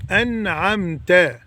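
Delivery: انعمت very slowly, the ت with a clear fatha; slow drilled word. Shown as a demonstration. Teacher drilling انعمت slowly, open final fatha. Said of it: "صراط الذين انعمت عليهم".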